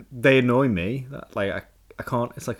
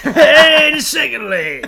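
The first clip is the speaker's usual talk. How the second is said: darts MC shout; 17 dB louder, slower than usual. Pretend. Continuing MC shout.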